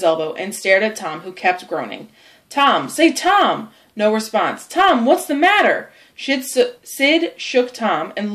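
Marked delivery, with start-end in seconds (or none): 2.5-3.7 rising to a higher call. Acted. Sid calling to groaning Tom who won't respond, calling out. 4.7-5.9 high-pitched worried question; alarmed. Acted. Sid to his groaning, unresponsive brother, alarmed.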